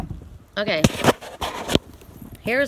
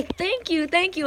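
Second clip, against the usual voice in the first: high, bright burst of thanks; excited thanks; 9 semitones higher, slower than usual. Shown as a demonstration. Reader showing viewers how to thank the universe, excited. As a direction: gush your thanks to the universe. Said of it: "Be excited".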